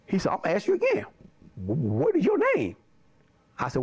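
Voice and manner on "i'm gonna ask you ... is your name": high put-on warning, then stammered question; mock principal interrogation; imitating the principal. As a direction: act out the stern principal asking. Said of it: "he started talking like this".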